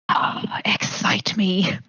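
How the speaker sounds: slow, breathy mock-swoon; swooning whisper. Fan reading out a comment about buff villains, swooning whisper.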